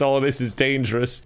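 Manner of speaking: ordinary talk, nothing marked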